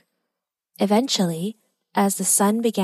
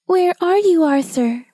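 high, plaintive little wail; tearful sniffling; 9 semitones higher, 4 dB louder than usual. A narrator's reading. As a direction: call out for a lost brother, on the edge of tears. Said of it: "she sniffed quietly".

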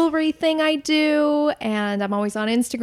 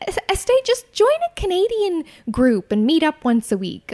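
a higher, louder nagging mom voice; imitating mom; 7 semitones higher, 3 dB louder than usual. Daughter retells her mom's advice on making friends, in a higher, louder mock-mom voice. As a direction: play her mum giving pushy advice, pitched up. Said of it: "my mom was like".

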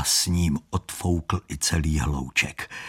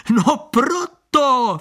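much higher, louder, drawn-out cry; shout; 5 dB louder, much slower than usual. Acted. Con man crying out as Standa backs down, loud and high. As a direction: bark it out triumphantly. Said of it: "vzkřikl chlapík".

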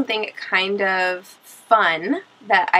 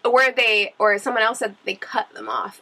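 normal talking voice

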